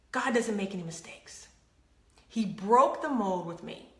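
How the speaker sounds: ordinary talk, nothing marked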